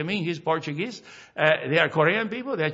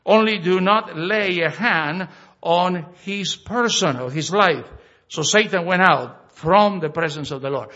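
scripture reading; 5 dB louder than usual. Acted. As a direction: proclaim the verses to the congregation. Reads scripture aloud.

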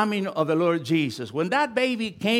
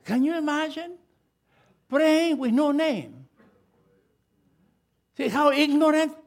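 higher, marvelling at the folly; incredulous; 8 semitones higher than usual. Real, not acted. Preacher scoffing to the church at the request, high and incredulous. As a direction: lift high in disbelief.

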